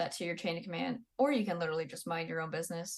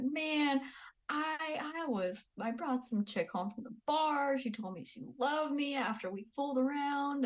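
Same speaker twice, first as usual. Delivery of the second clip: mock buddy brag; 7 semitones higher than usual. Husband bragging to NCO buddies.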